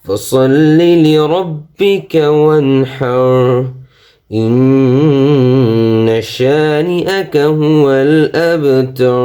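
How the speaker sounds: slow, high, melodic tilawat with long held vowels; full measured Quranic recitation. Shown as a demonstration. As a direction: chant the whole surah reverently and slowly. Full recitation after announcing.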